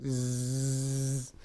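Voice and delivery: long, steady buzzing zzz; sustained mouth-made buzz on one note; camera whirr imitation. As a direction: imitate a film camera running. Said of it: "I'm just going".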